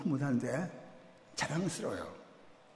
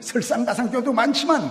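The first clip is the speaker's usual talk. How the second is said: punchline; 9 semitones higher, 8 dB louder than usual. Real, not acted. Pun punchline on churches.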